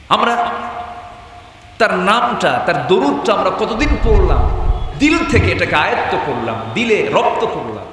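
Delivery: high, urgent sermon delivery; impassioned preaching. Real, not acted. Preacher exhorts at raised pitch.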